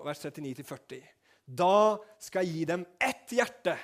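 loud, high, ringing sermon voice; preaching at full force. Acted. Pastor preaching loudly to the congregation, full force.

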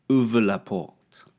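"Ouvre la porte." said slow and loud in careful French; French phrase. Shown as a demonstration. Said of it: "So stuff like".

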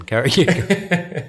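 slow, high, through giggles; laughing. Real, not acted. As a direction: still laughing, waving them on. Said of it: "I was laughing at something else".

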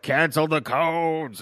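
mock frenzied order. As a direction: imitate a commander losing it. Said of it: "he's getting all crazy".

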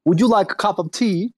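mock British gentleman offering tea; bad British accent. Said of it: "your British accent is fucking horrible".

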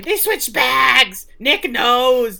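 high, loud mock scream; acted screaming. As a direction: yell it out as a frantic retelling. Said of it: "Andy starts screaming".

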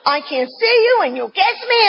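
high, mock-shrieked outrage, breaking into a cry; imitating her own hysterical tantrum; high wailing mock scream; retold screaming. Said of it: "I am screaming".

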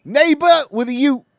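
shouted fake British accent, pitched high; loud, exaggerated British impression; mock British accent. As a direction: yell one word like a cartoon Brit. Mock British accent word game.

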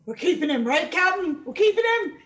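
high, insistent crew voice; eager crew pleading. Acted. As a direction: beg the captain eagerly, building with each phrase. Game master voices eager crew.

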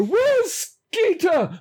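high, slow, panicky call; high panicked call for Skeeter; frightened cry. Acted. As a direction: pull back and cry out in fright. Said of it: "pull back in fright and go".

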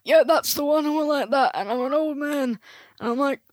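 put-on crotchety old miser act; old man Scrooge. Shown as a demonstration. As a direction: put on a really old man's voice. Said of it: "like, a really old voice".

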